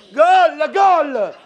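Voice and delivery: loud stadium cry of goal; goal shout. Pretend. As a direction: yell it like a football fan.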